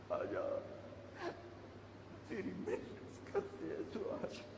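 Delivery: hushed, broken whisper to God; whispered plea. Real, not acted. Preacher whispering his last plea for God to come. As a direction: breathe the words out barely audible.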